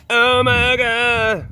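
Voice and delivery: high, drawn-out mock wail; mock gushing. Mimicking fans of two grown animals, mock gushing. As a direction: hold each vowel like an overcome fan. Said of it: "they're like".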